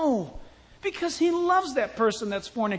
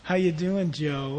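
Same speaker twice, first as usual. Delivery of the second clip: low, softer seductive woman; flirty Potiphar's wife; 7 semitones lower, 5 dB quieter than usual. Acted. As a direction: flirt in a low, hushed register. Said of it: "I think she talked maybe like".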